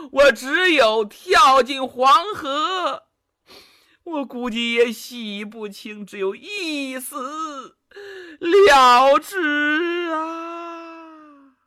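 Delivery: 带着哭腔，拖长尾音，声音抬高; 边哭边说，一字一顿拉长; 声泪俱下. Acted. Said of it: "说到这里声泪俱下呀". (哭腔拖长，越说越悲)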